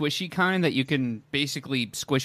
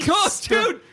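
excited shout. Real, not acted. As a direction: burst out with glee.

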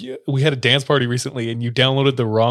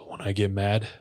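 brooding low voice; 4 semitones lower, 6 dB quieter than usual. Retold or imitated. Upset missionary kid confesses his temper to the teller, subdued. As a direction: retell it as a subdued, halting confession.

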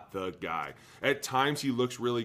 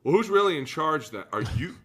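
louder, laughing, who's in charge; laughing mock doubt; 6 semitones higher, 8 dB louder than usual. Teammates doubting the new boss, loud laughing doubt. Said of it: "everyone's looking around like".

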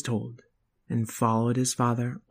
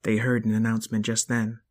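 plain reading voice

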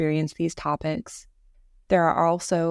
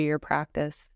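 calm, even voice with long pauses; guided meditation. Acted. Leading listeners through Yoga Nidra practice, guided meditation voice. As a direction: gently guide the listener into rest, leaving space between phrases.

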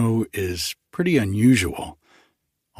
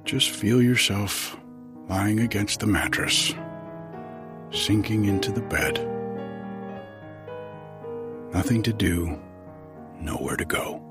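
sleep meditation; 6 dB quieter than usual. Acted. Leads a breathing meditation.

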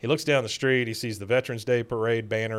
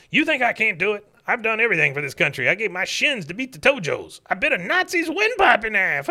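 higher, heated codger rant; mock angry old veteran; 9 semitones higher, 4 dB louder than usual. Cotton boasting of war deeds to the manager, mock rage. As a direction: rant like a crazed old soldier.

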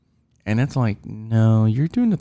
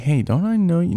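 higher, imitating a startled double take; mock surprised recognition; 8 semitones higher than usual. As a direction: pop up in pitch, like spotting a familiar face. Imagined student double-taking.

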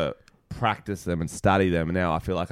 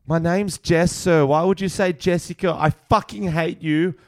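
put-on bratty teen outburst; 8 semitones higher than usual. Student snapping at teacher over her name, bratty tantrum. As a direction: storm at the teacher like a furious teen.

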